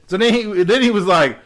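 usual speaking voice

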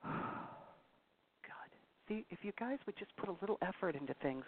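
sighed, breathy whisper of frustration; exasperated whisper. Real, not acted. Host whispering in exasperation at her lazy listeners. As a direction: whisper it, fed up and tired. Exasperated aside after sigh.

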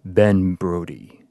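slow, deep booming announcer call; Mortal Kombat announcer. Pretend. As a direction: announce the winner like a fighting game. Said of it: "say it like the M K announcer".

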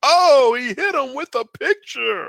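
high, loud burst of amazement; excited. Real, not acted. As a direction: gasp it out like you just saw it. Mock amazement at the replay.

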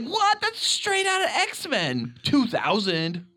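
high-pitched, stretched-out disbelief; slow, very high incredulous reaction; retold astonishment. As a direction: squeal it in amazed recognition. Retelling amazed reaction to shot.

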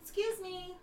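high, quick little critter voice; squeaky chipmunk voice. Acted. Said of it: "get on your chipmunk voice".

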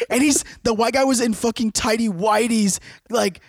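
ordinary delivery

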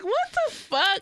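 high, slow, drawn-out disbelief; reacting to the socks video, high and slow; mock disbelief. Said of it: "I was like".

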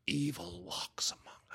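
hushed, voiceless horror tagline; playful ominous whisper; mock-spooky whisper. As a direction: whisper it like a movie-trailer warning. Mock-spooky tagline after film title.

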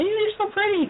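high gushing compliment; gushing interviewer. Retold or imitated. Interviewers fawning over natural hair, high gushing coo. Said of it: "first thing they say".

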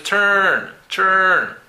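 "turn. Turn." with the er vowel held long; long vowel demo. Shown as a demonstration. Teacher repeats turn for learners, long vowel demo. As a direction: stretch both takes of "turn", falling. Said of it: "Los sonidos largos".

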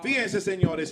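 ordinary talk

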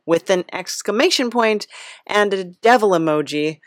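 ordinary talk

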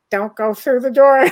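loud, urgent call to the spirits; urgent warning call. Retold or imitated. Retelling telling the lost pilots, a sharp loud warning. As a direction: shout a warning not to come through.

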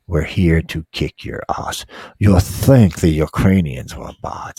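hammed-up soldier menace; mock military threat. NATO voiced warning Russia, mock menacing taunt.

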